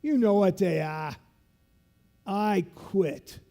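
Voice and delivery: hint of the loud Boston instructor taunting; South Boston instructor. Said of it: "he talks like this 'cause he's from South Boston".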